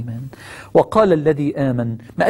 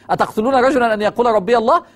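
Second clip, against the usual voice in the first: higher, chanted Quranic recitation; raised recitation; 6 semitones higher than usual. Acted. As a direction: lift the verse like a challenge.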